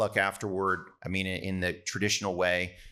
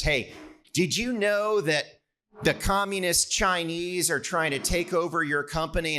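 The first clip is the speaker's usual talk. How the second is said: tongue-in-cheek question; 9 semitones higher than usual. Retold or imitated. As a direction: tease with a high, staged question. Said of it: "tongue in cheek by one of the senators".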